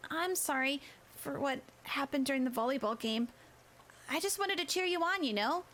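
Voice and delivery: put-on a girl saying sorry, a bit pained; apologetic girl voice. A narrator's reading. Kikamura apologizes.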